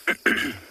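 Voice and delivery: sudden choking cough; coughing after inhaling a fly. Real, not acted. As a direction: cough out a swallowed bug. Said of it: "I just inhaled a fly".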